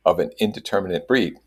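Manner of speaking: plain reading voice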